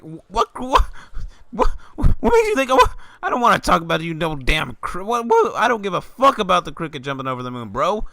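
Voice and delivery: loud, high, incredulous outburst at the chatty classmate; cracking yell of disbelief; exasperated rant. Retold or imitated. Ranting at imagined chatty classmate.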